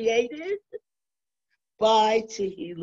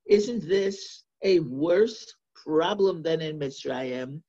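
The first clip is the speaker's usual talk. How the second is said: ordinary talk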